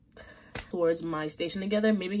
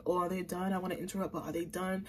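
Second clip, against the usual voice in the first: hushed waitress thought; 3 semitones lower, 11 dB quieter, faster than usual. Retold or imitated. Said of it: "look at us kind of like".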